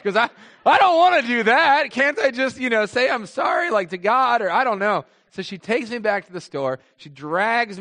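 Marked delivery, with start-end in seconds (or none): 0.0-5.0 high, louder, tearful begging; whiny pleading child. Retold or imitated. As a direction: beg your way out of it like a scared kid. Child pleading, crying twice as much.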